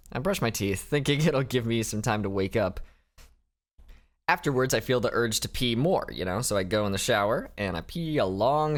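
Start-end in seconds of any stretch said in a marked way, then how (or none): none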